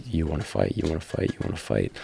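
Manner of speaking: hushed echo in the head, fading down; replaying inner thought. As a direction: repeat it softly, like a thought looping. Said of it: "that kept replaying in my mind".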